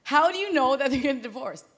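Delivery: high, loud, eager asking; eager curiosity. Retold or imitated. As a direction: beg to learn the secret. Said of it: "And the guy's like".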